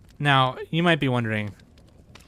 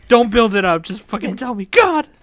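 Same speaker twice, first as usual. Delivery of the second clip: loud high exasperated yell; exasperated outburst; 9 semitones higher, 9 dB louder than usual. Pretend. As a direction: snap it out, fed up.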